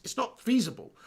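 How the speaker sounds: normal talking voice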